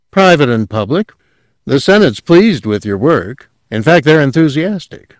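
a hint of the keen successor; eager young newcomer. A narrator's reading. Narrator voices young Witwer.

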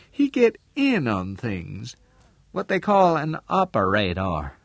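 Southern dialect woman, high and emphatic in places; shrewd businesswoman character. Acted. Missus Lane telling Al about Harmon's dealings, knowing.

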